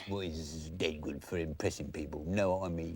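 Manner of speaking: low hushed smooth voice; quiet smoothie voice. Shown as a demonstration. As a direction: keep it soft and low. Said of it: "smoothie voice".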